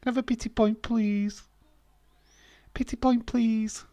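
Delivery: high, pleading little voice; whiny begging. Pretend. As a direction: beg for the point like a sulky kid. Begging quizmaster for pity point.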